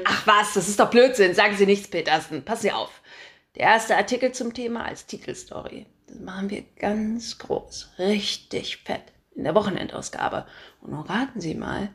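a pushy chief editor who loves his own voice; booming boss. Acted. Chief editor calling his reporter, booming and pushy.

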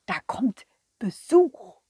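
whispered Santa aside; whispering. Acted. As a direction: whisper it secretly.

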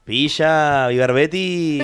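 put-on loud, high Sevillian accent; a playful put-on Sevillian accent, slow on the thanks. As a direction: imitate a Sevillian friend on the phone in a Seville accent. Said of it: "eso es sevillano".

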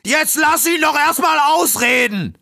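furious high bellow to let him finish; exasperated shout. Acted. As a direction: roar it to shut the fox up. Raccoon finally loses patience.

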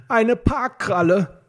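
high, drawn-out exclamation of dismay; despairing cry. A narrator's reading. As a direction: cry out slowly in disbelief. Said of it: "rief Peter und fuhr sich verzweifelt".

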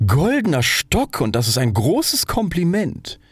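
high, slow, grandly stretched words; self-important. A narrator's reading. Rocket turning insult into praise, slow and pompous.

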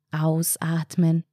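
slow, monotone relaxation instruction; breathing-exercise cue. Acted. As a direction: stretch it out evenly, like a relaxation coach. Entspannte Atemübung im Kopf angesagt. (langsam und monoton, wie bei einer Atemübung)

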